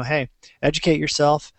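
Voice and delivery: ordinary talk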